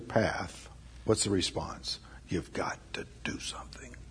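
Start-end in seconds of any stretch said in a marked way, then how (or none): 2.3-4.0 whisper. Real, not acted. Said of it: "hushed closing appeal".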